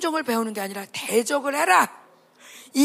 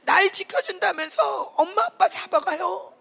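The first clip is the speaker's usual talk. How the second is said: tearful prayer; 6 semitones higher than usual. Real, not acted. Preacher leads tearful prayer.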